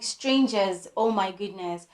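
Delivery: ordinary delivery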